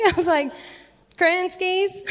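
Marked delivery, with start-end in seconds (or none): 1.2-2.0 high, sheepish questioning repeat; mock-awkward little question. Repeats awkward question to puzzled stranger.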